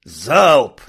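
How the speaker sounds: short, loud battle cry; shouted order. Acted. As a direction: bellow the command to fire. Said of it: "ответил ягер и проорал".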